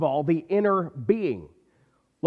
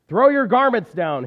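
cross donkey, louder and higher; 5 semitones higher, 6 dB louder than usual. A narrator's reading. Proud donkey crossly ordering the townsfolk, loud. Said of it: "he said crossly".

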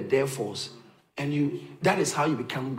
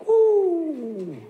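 excited ooh; 14 semitones higher, 8 dB louder than usual. Real, not acted. Preacher exclaims over living hope.

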